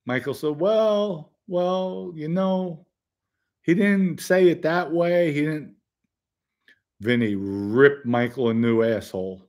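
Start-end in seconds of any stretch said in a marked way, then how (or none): 0.6-5.7 high-pitched, stalling excuse; mock defensive hedging, pitched up; retold backpedalling. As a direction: imitate a man squirming out of it. Michael backpedals when confronted in retelling.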